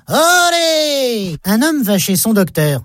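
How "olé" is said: high, drawn-out olé with a changed voice; bullfighter's olé. A narrator's reading. Housewife dodging the falling child, high drawn-out olé. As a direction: cheer it like a bullfight crowd.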